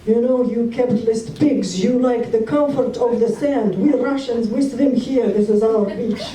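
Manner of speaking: teasing wife in a put-on Russian accent; heavy Russian accent. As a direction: ham up a Russian comrade scolding capitalists. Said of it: "said with a heavy Russian accent".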